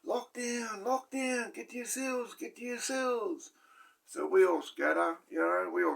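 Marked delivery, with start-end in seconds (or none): 0.0-3.5 high barked mock prison-guard order; officer calling lockdown down the block, yelling high. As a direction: call the lockdown like a guard up on the tier. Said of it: "C O yells out".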